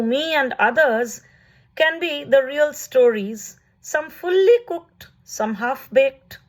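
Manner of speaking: measured, lifted poetry-reading voice, pausing between phrases; poem reading. Acted. Poet reading a prose poem to online friends, lyrical and measured. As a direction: read it like verse for an audience.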